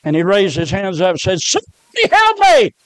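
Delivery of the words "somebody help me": very high, loud scream for help; retold scream. Preacher voices the man dreamed in hell, screaming. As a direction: scream out as if from the flames. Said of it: "screaming in that fiery ocean".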